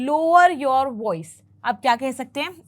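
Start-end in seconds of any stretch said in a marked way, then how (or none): 0.1-1.4 slow correct example; right usage, said slowly. Shown as a demonstration. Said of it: "तब आप कह सकते हैं कि".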